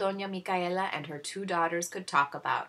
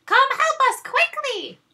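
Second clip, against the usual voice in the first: much higher, louder bossy scream; screaming demand; 13 dB louder than usual. Acted. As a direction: yell orders at the servant. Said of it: "they screamed".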